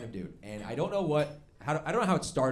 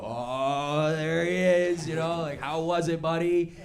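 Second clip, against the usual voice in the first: teasing buddies' welcome; 4 semitones higher, 5 dB louder, slower than usual. Retold or imitated. Friends greeting him, retold.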